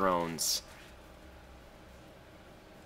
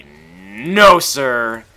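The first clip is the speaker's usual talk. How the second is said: louder, cocky no sir; smug refusal; 2 semitones higher, 12 dB louder than usual. Retold or imitated. Gamer voicing himself killing the pit, smug no sir. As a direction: say it like a cocky denial. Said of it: "I say".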